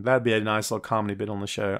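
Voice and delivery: ordinary talk, nothing marked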